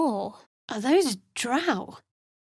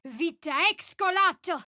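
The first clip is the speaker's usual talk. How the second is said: higher, fervent invocation; spell incantation; 7 semitones higher than usual. Acted. Cleric healing an ally, fervent invocation. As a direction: pray it out with conviction.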